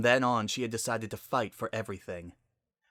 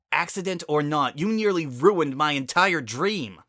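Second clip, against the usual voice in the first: angry; 6 semitones higher, 8 dB louder than usual. Acted. Angry retort.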